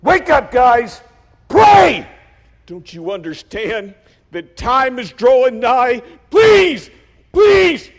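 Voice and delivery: high, loud, drawn-out begging shouts; urgent shouting. Retold or imitated. Jesus waking his sleeping disciples, urgent pleading shouts. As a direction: yell to rouse sleepers, desperate.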